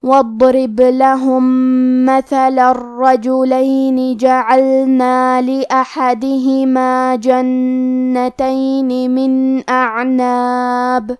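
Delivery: slow, melodic Quran chant; tajweed recitation. Shown as a demonstration. Teacher recites the verses for students to hear, melodic chant. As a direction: recite slowly, with full tajweed. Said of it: "تلاوت تجوید کے ساتھ سماعت فرمائیں".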